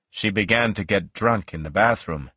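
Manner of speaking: ordinary delivery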